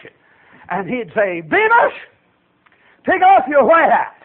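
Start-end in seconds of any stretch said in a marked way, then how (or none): 1.4-4.1 high-pitched called-out order; drill sergeant command. Retold or imitated. Sergeant orders recruit on the march, high barked command. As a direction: call the name sharply high, then command.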